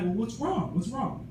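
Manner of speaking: put-on high, soft questioning of an upset child; acting out a worried parent gently asking; retold concern. Voicing himself asking crying daughter.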